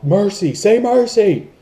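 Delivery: high, loud mercy call; yelled. Retold or imitated. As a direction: shout it like demanding surrender.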